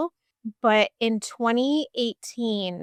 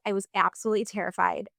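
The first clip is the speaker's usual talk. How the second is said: ordinary delivery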